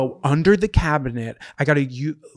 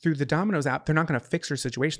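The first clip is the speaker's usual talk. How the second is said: ordinary delivery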